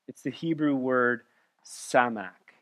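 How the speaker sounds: normal talking voice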